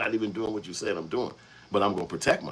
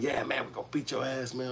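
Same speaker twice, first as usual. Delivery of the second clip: mock threat; 7 semitones higher, 3 dB quieter than usual. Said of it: "So he keeps screaming".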